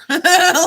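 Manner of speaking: high, loud cackle; real laughing burst; loud burst of laughter. Real, not acted. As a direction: laugh out at the joke. Laughs at own bear voice.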